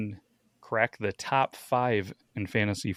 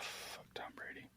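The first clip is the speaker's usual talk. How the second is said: whispering; 23 dB quieter, slower than usual. Real, not acted. Hated rival named, whispered aside.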